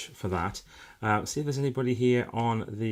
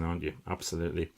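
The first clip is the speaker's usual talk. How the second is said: ordinary talk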